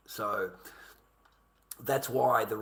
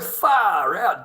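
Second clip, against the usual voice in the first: usual speaking voice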